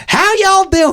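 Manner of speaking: very high mock southern accent; hammed-up southern belle accent; thick southern accent. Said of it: "the just most southern".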